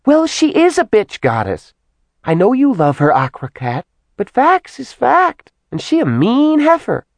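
put-on high, chattering little girl voice; childlike demon voice. A narrator's reading. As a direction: tattle on her like a child. Simi character voice.

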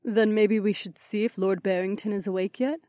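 high, even female-character voice; Aliona's character voice. Acted. Aliona suggesting they check on Barrington, high voice.

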